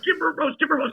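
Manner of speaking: very high, insistent repetition; squeaky-high acted pleading; mock urgent nagging. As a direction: imitate a frantic prompting friend. Friend nagging him, acted out.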